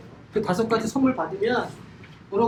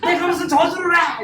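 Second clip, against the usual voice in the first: mock indignant wife; 6 semitones higher, 4 dB louder than usual. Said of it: "이렇게 저주를 해서".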